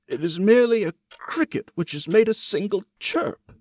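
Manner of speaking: high, loud, anxious reassurance; old man's frightened self-talk. A narrator's reading. Old man soothing himself in the dark, high and loud. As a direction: talk yourself out of the fear, high and strained. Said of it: "He had been saying to himself".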